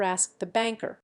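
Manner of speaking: ordinary delivery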